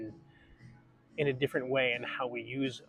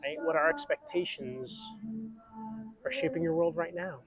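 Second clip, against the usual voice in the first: guided breathing; 3 semitones higher than usual. Acted. Leads a breathing exercise.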